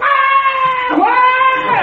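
high mock baby crying wail. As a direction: wail like a fussy infant, hammed-up. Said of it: "they start".

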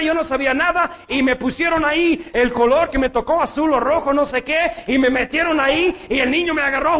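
ordinary talk, nothing marked

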